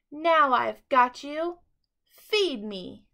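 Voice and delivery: loud, slow triumphant growl; gloating shout. A narrator's reading. Fox shouting at trapped friends as door slams. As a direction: relish the catch. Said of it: "shouted Foxy Loxy".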